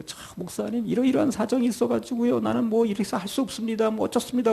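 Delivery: a soft, high, coaxing excuse to the pastor; pleading to the pastor. Acted. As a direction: sweet-talk the pastor quietly. Voicing a member pleading to pastor.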